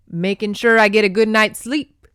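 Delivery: cocky and amused; smug Scot. Acted. Captor explains why he ties her, smug and loud.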